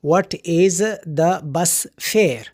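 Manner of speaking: "What is the bus fare" said very slowly, each word apart; slow example sentence. Shown as a demonstration. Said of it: "اس طرح استعمال کر سکتے ہیں". ("What is the bus fare" کو بہت آہستہ، ہر لفظ الگ کر کے پڑھیں)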